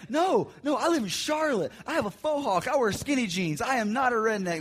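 high, loud indignant denial; defensive high-pitched objection; flustered protest. Retold or imitated. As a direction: protest too much, offended. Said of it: "all frustrated and flustered and mad".

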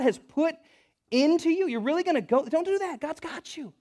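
high-pitched, urgent exhortation; impassioned preaching. Acted. Abigail urges David to think back, impassioned preaching. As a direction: plead and preach at full pitch.

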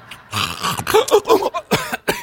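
high, choking gasp for breath; hammed-up snorting gasp; imitated gasping snort. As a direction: mimic a snorer jolted awake gasping for air. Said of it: "gasping for breath".

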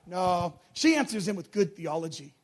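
usual speaking voice

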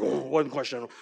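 low, quiet, stern official; mock-serious official; serious examiner. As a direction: imitate a solemn examiner, low and soft. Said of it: "so serious".

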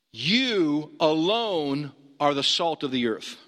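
slow, deliberate "You alone" pressed hard; preacher hammering "You alone" home; stress on you alone. Shown as a demonstration. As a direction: spell out "You alone" word by word, slowly. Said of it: "So that means you alone".

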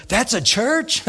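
high-pitched astonished question; floored disbelief. Retold or imitated. Stranger hears the old nightclub is a church, astonished. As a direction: ask in amazed disbelief.

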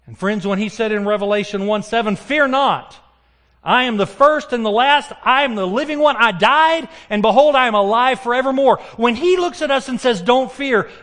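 high, loud proclaiming; fervent preaching. Acted. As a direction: proclaim it with full force. Preaching at full force.

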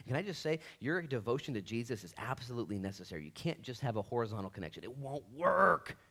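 ordinary talk, nothing marked

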